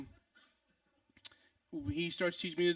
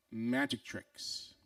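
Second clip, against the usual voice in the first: drawn out very slowly, lower; dramatic reveal; 6 semitones lower, much slower than usual. Retold or imitated. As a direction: imitate a guru slowly unveiling his great secret. Friend solemnly reveals his secret.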